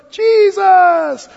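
high, loud Sunday school shout; imitating a kid, piercingly high; little kid answering. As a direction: yell the answer like an eager child. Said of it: "you're a little kid and they ask".

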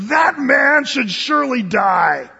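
acted enraged king passing sentence; furious outburst. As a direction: thunder out the verdict slowly. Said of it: "stood up in a fury, in a rage".

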